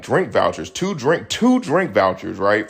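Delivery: normal talking voice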